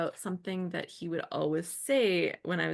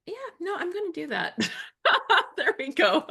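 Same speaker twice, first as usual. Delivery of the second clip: laughing; 12 semitones higher, 6 dB louder, faster than usual. Real, not acted. Laughing while finding it.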